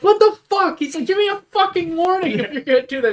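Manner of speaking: high-pitched mock outrage; indignant outburst. Retelling a startled character demanding a warning, indignant. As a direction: squawk it as a startled, annoyed character. Acted angry outburst from movie.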